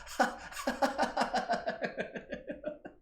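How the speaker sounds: high, breathy cascading laugh. Real, not acted. Leading group laughter, high breathy laughing. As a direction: let the laugh spill out high. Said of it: "get those weird laughs in there".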